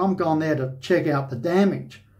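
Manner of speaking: normal talking voice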